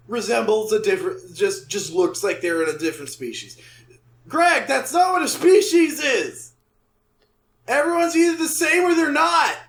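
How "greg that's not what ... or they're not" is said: loud, high-pitched mock outrage; exasperated rant. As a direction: scold an absent writer in exasperation. Exasperated rant at absent writer.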